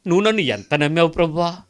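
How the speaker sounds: preaching with full fervour; fervent preaching prayer. Acted. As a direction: cry out to the Lord from the pulpit. Preacher addresses Lord in prayer.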